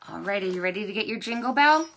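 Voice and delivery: ordinary delivery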